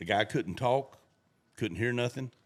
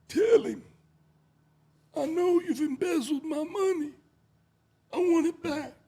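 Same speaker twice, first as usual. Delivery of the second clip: high, loud mock mob boss bark; hammed-up gangster boss demand; 4 dB louder than usual. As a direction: ham up the boss's threat. Mob boss voiced in joke.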